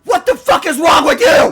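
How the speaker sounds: high, furious scream; angry yelling. Real, not acted. Screaming at the sixty-year-old driver, angry yelling. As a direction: scream it at the offender. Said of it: "I wasn't yelling so much".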